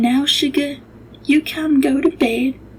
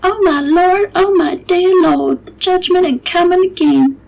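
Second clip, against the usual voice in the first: higher, louder, slowed wailing prayer; alarmed lament; 5 semitones higher, 3 dB louder than usual. Acted. The cook hearing of the ghost, wailing in dread. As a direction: cry out to the Lord in dread. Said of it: "and she said".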